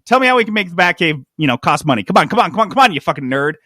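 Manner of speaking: mock greedy executive talking over the comic writer; impatient executive. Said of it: "who's waving his hand during the whole thing".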